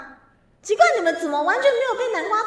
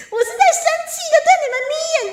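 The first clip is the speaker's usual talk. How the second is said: much higher, louder, fast whiny yell; mock angry shout; 12 semitones higher, 8 dB louder, much faster than usual. Streamer insisting to viewers she is angry, hammed-up shouting whine. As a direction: blow up in hammed-up fury at chat. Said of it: "我生气了".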